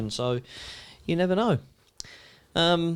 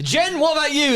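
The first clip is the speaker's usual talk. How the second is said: much higher mock boss question; imitating the boss; 11 semitones higher than usual. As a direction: pitch the boss up high and bright. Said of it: "S0: "Jen".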